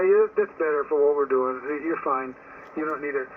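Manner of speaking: normal talking voice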